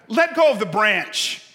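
loud, high command from above; voice from heaven. Acted. God gives the hanging man his order, calling down. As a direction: call the order down firmly. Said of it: "voice from heaven comes back".

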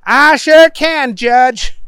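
high, loud, drawn-out reply; eager Booger. A narrator's reading. Defendant agreeing with the judge, high and eager. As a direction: agree loudly in a high put-on voice. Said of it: "said Booger".

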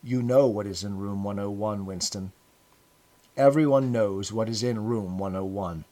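dry, understated and matter-of-fact. Acted. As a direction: answer dryly, without any warmth. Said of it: "He answered dryly".

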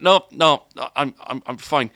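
insistent. Retold or imitated. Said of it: "like that".

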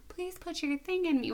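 high, soft mock plea; hammed-up polite begging; begging nicely. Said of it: "beg him really nicely".

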